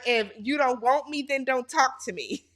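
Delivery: normal talking voice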